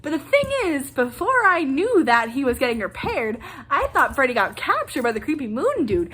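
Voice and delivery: normal talking voice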